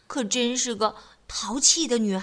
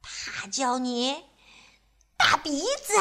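teasing Miss Trouble; slower than usual. Acted. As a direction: savour the insult. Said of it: "说".

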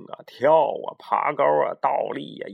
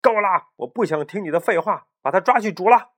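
angry captain; 5 semitones higher, 5 dB louder than usual. Acted. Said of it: "这时候发火了".

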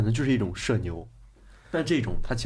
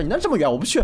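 higher, curt mock refusal; stubborn auntie; 7 semitones higher, 3 dB louder, faster than usual. Auntie refusing the young man's seat, mock curt. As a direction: brush him off like a stubborn old woman. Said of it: "大妈说".

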